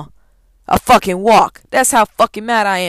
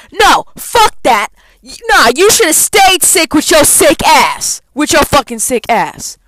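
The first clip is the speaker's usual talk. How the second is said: louder, higher, slower angry taunt; furious jab; 3 semitones higher, 4 dB louder than usual. Real, not acted. Student ranting at the sick professor, loud slow scorn. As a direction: spit it at him with contempt.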